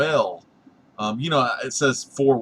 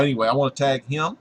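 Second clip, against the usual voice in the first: normal talking voice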